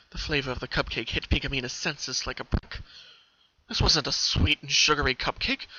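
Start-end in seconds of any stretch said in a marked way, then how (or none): none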